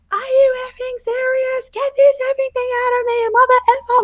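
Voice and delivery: high, loud, furious retold swearing; raging swearing. Mother in labour swears at the pain, furious high shout. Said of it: "I was raging and I was swearing".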